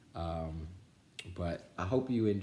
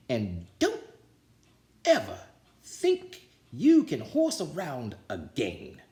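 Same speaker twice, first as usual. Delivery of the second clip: louder, slow, threat with a sneer; menacing coach warning; 4 semitones higher, 4 dB louder, slower than usual. A narrator's reading. Coach lectures the team, slow gloating threat. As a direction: warn them with a nasty chuckle.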